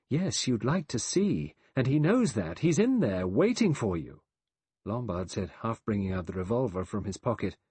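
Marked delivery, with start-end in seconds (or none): 0.1-4.2 high, agitated warning; crying out. A narrator's reading. Vera warns Lombard of the trap, crying out. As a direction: warn him urgently, pitch up. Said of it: "Vera cried".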